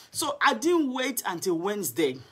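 ordinary talk

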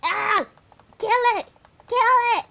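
high, loud excited scream. Real, not acted. Kid gamer screaming at a sheep, real excited yell. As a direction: shriek at the sheep to die. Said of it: "I'm sorry for screaming".

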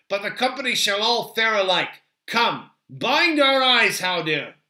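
loud, high, decisive order; firm leader ending an argument; commanding Aragorn. Acted. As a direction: rule on the matter forcefully.

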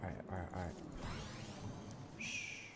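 very soft, barely voiced; hushed whisper. Real, not acted. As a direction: whisper so the enemy won't hear. Sneaking near enemies.

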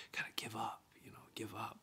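barely voiced, ghostly whisper of doubt; faint whisper. Pastor voices the inner doubt whispering give up, faint whisper. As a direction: let it slip out like a voice in your head. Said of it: "these voices that you hear that say".

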